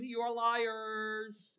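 playful, very drawn-out accusation; teasing accusation. Pretend. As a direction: stretch the words out to tease the crowd. Teasing the congregation about denying it.

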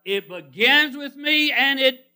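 loud, high, slow preaching; big pulpit build-up; soaring proclamation. Retold or imitated. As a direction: swell and hold it before the drop. Builds contrast of beginning and end.

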